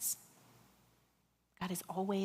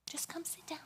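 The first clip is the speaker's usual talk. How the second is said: breathy soft invitation; hushed whisper of God; 6 semitones higher, 11 dB quieter than usual. Retold or imitated. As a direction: whisper it close and gentle. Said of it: "in a whisper".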